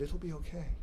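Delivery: soft hushed reassurance; whispered. A narrator's reading. Boy whispering comfort to his sister. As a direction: barely breathe it to his sister.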